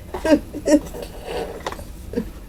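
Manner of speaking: high, wheezy giggles; laughing. Real, not acted. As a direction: break into breathless high laughter. Cracking up at the message.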